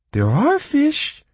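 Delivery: very high, loud, slow squeak; delighted creature. A narrator's reading. Bog creature cheering up about fish, delighted. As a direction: pipe it out with delight. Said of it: "the creature shone".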